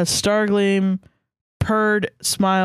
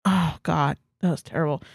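ordinary delivery, nothing marked

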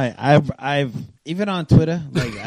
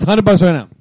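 louder, pushing the bet; 8 dB louder than usual. Real, not acted. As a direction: slam the bet down loud. Putting money down.

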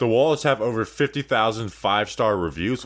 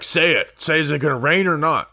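raised, louder re-enacted demand; mock demanding; 6 semitones higher, 5 dB louder than usual. Host retelling how they pressed the weatherman, mock demanding. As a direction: push the question up, mock-insistent.

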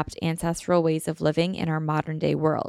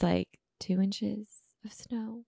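whispering; 3 semitones higher, 9 dB quieter, slower than usual. Real, not acted. Whispered aside.

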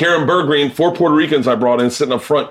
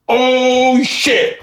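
high startled "Oh, shit" with a laugh; a man caught out, suddenly horrified; shocked; 11 semitones higher than usual. Retold or imitated. Guy realizing his mistake, high shocked outburst.

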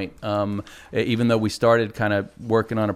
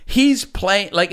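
usual speaking voice

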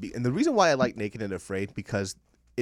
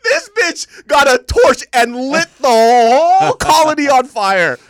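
much higher, loud and slow, half crying out; real shocked outburst; 15 dB louder, slower than usual. Real, not acted. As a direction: shout it out in real disbelief. Shocked retelling of torching colony.

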